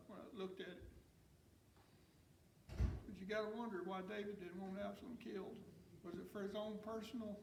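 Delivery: ordinary delivery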